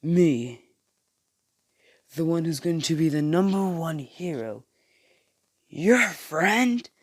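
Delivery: loud, high, incredulous mockery; laughing scorn. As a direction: scoff in disbelief, pitch leaping on "friend". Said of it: "Bakugo laughs and says".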